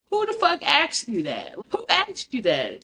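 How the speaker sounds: incredulous clapback; indignant. Real, not acted. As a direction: call it out in disbelief. Indignant reaction to the quote.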